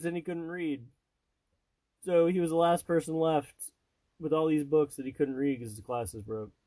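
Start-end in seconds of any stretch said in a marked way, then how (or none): none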